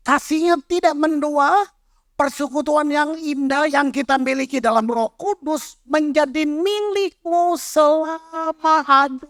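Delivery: scripture reading. Acted. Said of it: "yang The Passion tadi".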